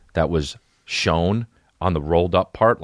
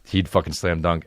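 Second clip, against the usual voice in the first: usual speaking voice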